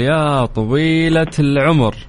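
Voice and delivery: drawn-out quiz catchphrase. Acted. Quiz host's catchphrase question intro.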